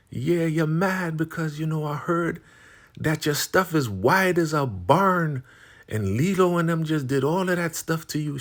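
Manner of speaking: imitating a gloating older rival; taunting. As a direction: rub it in with a high-pitched mocking voice. Taunting insult in acted quarrel.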